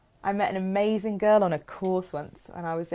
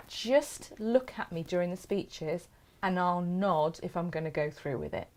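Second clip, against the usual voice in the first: ordinary talk, nothing marked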